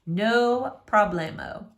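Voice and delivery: usual speaking voice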